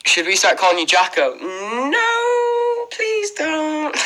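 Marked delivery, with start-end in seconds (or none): 1.4-4.0 much higher, squeaky no please don't; squeaky mock plea. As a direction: beg off in a tiny cartoon voice. Refusing the nickname Jacko.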